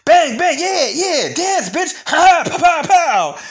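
high, loud cartoon-villain yell, hammed up; squeaky hammed-up villain shouting taunts. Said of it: "He's like".

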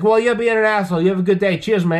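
high, loud Aussie send-off; Aussie Cossack. Retold or imitated. YouTuber signing off to a cop, mimicked loud and cheeky. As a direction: hurl a brash farewell. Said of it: "he's the Aussie Cossack".

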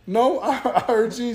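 can't hold back a laugh; laughing reply. Retold or imitated. Answering his mom who asks if he's ignoring her, laughing.

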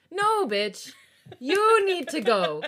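loud, high scolding with drawn-out YOU; slow, emphatic shout, leaning on each demand; exasperated yelling. Real, not acted. Reader ordering the character to go apologize, exasperated shout.

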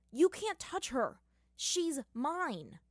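slow, high, possessive yell; jealous outburst. Acted. Aang yells at Zuko over Katara, slow and high.

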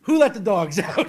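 lyric said through laughter; can't keep a straight face; giggling through the hook; laughing. Real, not acted.

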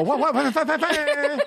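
high sung horn noises; mock air horn. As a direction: imitate an air horn with your voice. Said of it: "I go".

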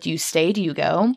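ordinary talk, nothing marked

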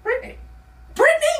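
a startled yelp at the name; shocked double take. Retold or imitated. Seeing the ex's name on the phone, a high shocked double take.

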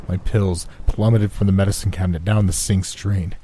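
ordinary delivery

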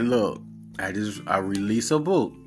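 usual speaking voice

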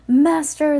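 high loud shout of Master; joyful cry. A narrator's reading. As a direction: cry out in devotion. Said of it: "they cried".

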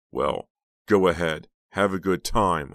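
sneering. A narrator's reading. Uncle's sneering permission.

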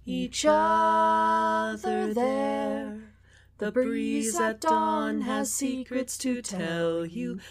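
plain reading voice

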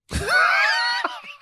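sudden high shrieking burst of laughter. Real, not acted. Laughing at her own answer.

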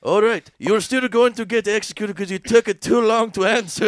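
high loud put-on host voice; hammed-up host verdict; mock host. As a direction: deliver the sentence like a cheery host. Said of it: "he's like".

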